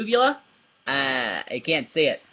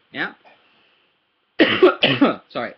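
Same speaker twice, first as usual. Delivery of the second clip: ordinary talk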